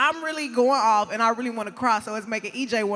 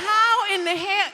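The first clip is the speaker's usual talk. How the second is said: higher, louder and slower in amazement; amazed; 8 semitones higher, 4 dB louder, slower than usual. Retold or imitated. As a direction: exclaim it in disbelief. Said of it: "I said".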